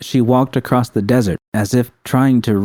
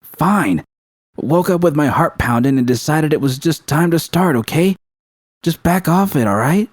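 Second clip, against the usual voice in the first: higher, irritated snap, pushing her away; snapping irritably; 7 semitones higher than usual. Acted. V snapping at Judy to back off, irritated. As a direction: bite back, fed up. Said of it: "she had snapped at Judy".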